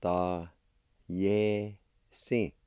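slow, each syllable held apart; teacher's careful syllable demo. Shown as a demonstration. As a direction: sound out "Ta, ye, si" with long pauses. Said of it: "It's pronounced like this".

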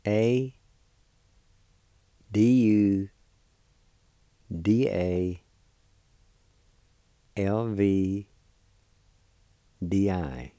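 letters in measured groups, well spaced; letter-by-letter spelling. Shown as a demonstration. Teacher spelling a word for learners, letter by letter. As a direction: spell it out slowly with long pauses. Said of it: "It's spelled like this".

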